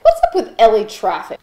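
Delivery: normal talking voice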